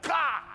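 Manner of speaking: loud, high "ah" of delight; awestruck cry. Retold or imitated. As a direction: cry out in excited awe. Retold awe at bikers.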